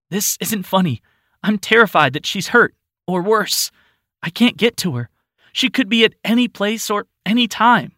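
put-on rising panic over a missing child; frustrated and scared. Flo to her father about her missing daughter, frustrated and frightened. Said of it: "Flo was clearly frustrated".

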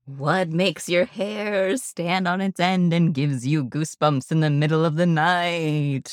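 spooky voice. Pretend. Host teasing the topic to listeners, mock spooky voice. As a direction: stretch it out like a hammed-up horror host. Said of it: "we're doing the spooky voice".

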